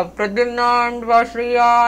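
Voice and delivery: loud, drawn-out name call; loudspeaker-style calling. Shown as a demonstration. Mother showing child how teachers might summon pupils, calling loudly. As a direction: call the names as if through a loudspeaker. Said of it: "ಹಿಂಗ್ ಏನಾರ ಇಟ್ಕೊಂಡ್ಬಿಟ್ಟು ಕೂಗ್ತಾರಾ".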